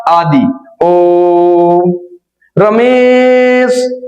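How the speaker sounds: ओम and रमेश stretched very slowly, vowel held long; long-held calling vowel. Shown as a demonstration. Teacher holds the vowel long to show pluta. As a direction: hold the vowel long like a distant call. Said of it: "sound बढ़ेगा आपका".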